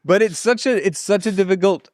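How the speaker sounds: host still laughing, picking up his point. Real, not acted. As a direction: talk on through leftover laughter. Still laughing after joke.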